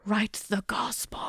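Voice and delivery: unvoiced ominous whisper. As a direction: breathe it like a secret.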